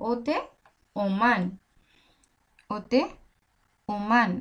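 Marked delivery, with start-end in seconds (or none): none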